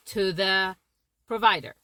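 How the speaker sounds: normal talking voice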